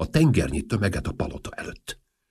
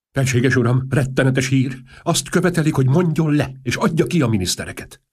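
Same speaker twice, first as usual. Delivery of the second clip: loud, higher breathless news; alarmed report; 7 semitones higher, 6 dB louder than usual. Acted. Press chief rushes in to the king, loud alarmed report. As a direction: burst in with terrible news.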